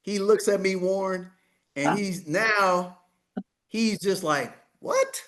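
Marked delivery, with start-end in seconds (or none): 4.8-5.2 very high shocked question; stunned what. Retold or imitated. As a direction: jump high in disbelief. Developer stunned.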